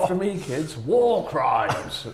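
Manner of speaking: loud, high and very slow drill; mock teacher drill. Joking teacher drilling kids on war crimes, loud and slow. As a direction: belt it out like a teacher drilling a class. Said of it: "they'll be like".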